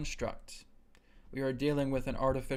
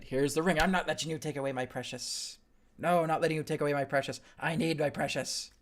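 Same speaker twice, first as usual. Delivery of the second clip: louder clingy Gollum voice, a light impression; 2 semitones higher, 4 dB louder than usual. Teacher voicing Gollum guarding the ring, lightly played possessive. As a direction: clutch the ring and refuse to give it up. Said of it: "the Gollum Lord of the Rings example".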